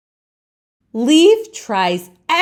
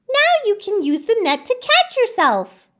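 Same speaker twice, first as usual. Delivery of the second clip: much higher, bright helper voice; a cheerful friend with a plan, pitched way up; eager friend Laurel; 13 semitones higher, faster than usual. Acted. Laurel encourages Leaf, much higher voice. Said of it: "Laurel says".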